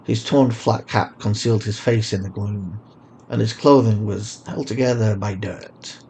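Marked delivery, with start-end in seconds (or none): none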